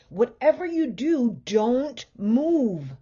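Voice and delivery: husband warning his family as the bed sinks; urgent shout. A narrator's reading. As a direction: bark the warning again. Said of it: "shouted Mister Watson".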